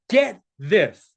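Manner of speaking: usual speaking voice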